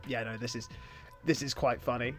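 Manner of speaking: low, soft deadpan murmur; quiet deadpan aside. Retold or imitated. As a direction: mutter it dryly to yourself. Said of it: "it's just like".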